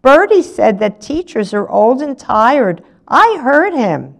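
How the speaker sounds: high-pitched, loud tattling; blurted out. A narrator's reading. Olive telling the teacher what Bertie said, blurted out high. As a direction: pipe up like an outraged little informer. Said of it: "she blurted out".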